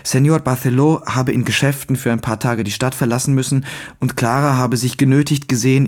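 plain reading voice

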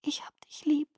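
child's soft unvoiced whisper. A narrator's reading. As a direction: breathe it tearfully. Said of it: "flüsterte sie".

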